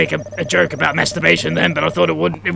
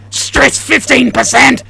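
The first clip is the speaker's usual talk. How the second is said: raised, high score announcement; alarmed shout; 7 semitones higher, 5 dB louder than usual. Acted. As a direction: shout the stress jump in mock alarm. Player announces stress score jump.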